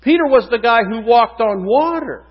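usual speaking voice

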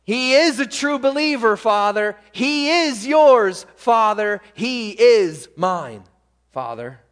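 loud, high preaching that drops on the last "Father"; impassioned intercession. Acted. Preacher voices Jesus defending a believer, impassioned intercession. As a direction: advocate forcefully, leaning on "is".

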